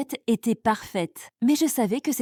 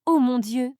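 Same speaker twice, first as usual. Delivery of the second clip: ordinary delivery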